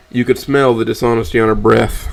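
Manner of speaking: usual speaking voice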